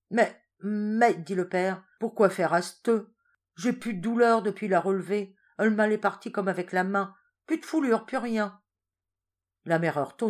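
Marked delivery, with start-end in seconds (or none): 0.1-8.6 louder, higher puzzled peasant; husband telling his wife the pain is gone; baffled old husband. Acted. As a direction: protest cheerfully you're cured.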